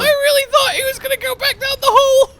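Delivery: squealing high and loud; excited shriek. Real, not acted. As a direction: shriek it out in delight.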